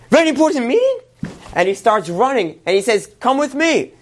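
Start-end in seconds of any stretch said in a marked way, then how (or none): 0.1-1.0 very high, rising astonished question; astonished echo. Acted. Doorman's alarmed echo of Dean. 3.2-3.9 high, urgent call to follow; rally him to come along at once; urgent summons. Acted. Doorman urgently leads the way.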